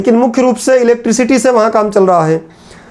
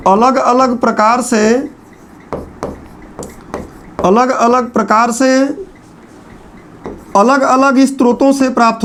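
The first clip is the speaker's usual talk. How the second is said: slow dictation. Shown as a demonstration. Dictates notes slowly.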